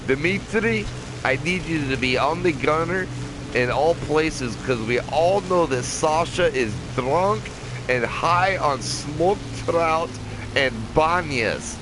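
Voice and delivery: high-pitched, drawn-out mock Russian; fake Russian accent. Gamer giving pretend orders to Dimitri about Sasha, fake Russian accent. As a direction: play a slow, singsong Russian commander. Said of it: "that's my fake Russian accent".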